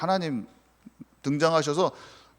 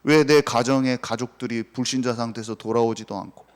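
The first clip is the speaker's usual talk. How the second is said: ordinary delivery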